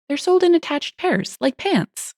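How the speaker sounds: high-pitched, helpful clerk explanation; patient explaining. Acted. Clerk explaining to a confused customer.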